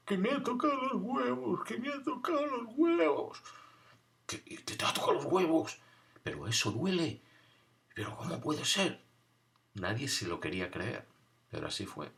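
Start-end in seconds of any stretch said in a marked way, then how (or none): 0.1-3.4 put-on very high, whiny child voice; crying little boy. Little boy sobbing to the older kids, very high and whiny. 4.3-9.0 put-on higher, disbelieving boy; incredulous. Boys hearing the news from the crying kid, higher and incredulous.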